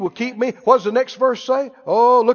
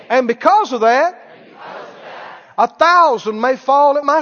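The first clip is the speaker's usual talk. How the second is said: call-and-response declaration in short phrases; deliberate, firm confession of faith, building stronger; said out loud for repeating. Shown as a demonstration. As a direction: lead the congregation phrase by phrase, pausing after each. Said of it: "every voice said out loud".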